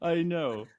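ordinary talk

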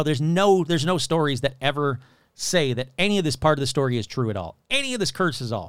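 ordinary talk, nothing marked

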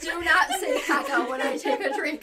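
loud, high, laughing through the words; laughing after a near spit take; laughing hard. Real, not acted. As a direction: cry out through real laughter after nearly spitting a drink. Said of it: "Spit take?".

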